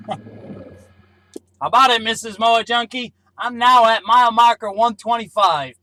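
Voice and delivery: ordinary talk, nothing marked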